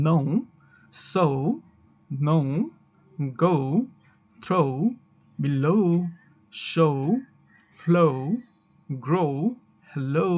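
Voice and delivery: "no", "so", "go", "throw", "below", "show", "flow", "grow", "hello" each ending in o-u; word list with every o gliding into oo; UK and US pronunciation. Shown as a demonstration. Teacher recapping for learners, each word spaced out with gliding o-u. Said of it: "USA তে এ ধরনের উচ্চারণগুলো এভাবে করা হয়".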